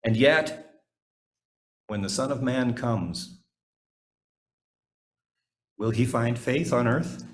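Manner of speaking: normal talking voice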